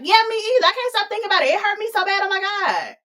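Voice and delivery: high, hammed-up me-too outburst. Y G peeping game and butting in, high and dramatic. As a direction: butt in high and gushing. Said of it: "Y G peeping game is like".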